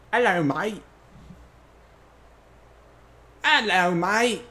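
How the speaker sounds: loud high Australian hello mate; loud, high-pitched Australian greeting; Australian accent. Shown as a demonstration. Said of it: "not terribly off from Wally".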